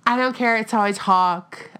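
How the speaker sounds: ordinary talk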